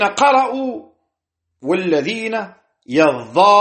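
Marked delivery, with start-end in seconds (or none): 2.9-3.6 "يظاهرون" as yaZZaaharuuna with a long held aa; doubled Za, long alif. Shown as a demonstration. Teacher repeating who read it so, "يظاهرون" with doubled Za and held alif. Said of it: "بفتح الياء وتشديد الظاء وألف بعدها".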